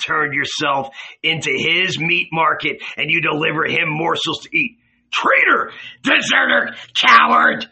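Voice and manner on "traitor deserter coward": high, loud accusing yells; raging cries of betrayal; furious shout. Acted. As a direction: spit each insult at him like a curse. Judas hurling insults at Jesus.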